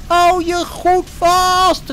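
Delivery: high, slow shout to hold on; calling out. A narrator's reading. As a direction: Jet yells a warning over the waves. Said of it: "riep Jet".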